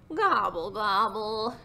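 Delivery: mild turkey grumble; grumbled gobble. Acted. Turkey sulking after Rooster saw through it, mild grumble. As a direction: grumble the two gobbles lightly. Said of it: "grumbled Turkey".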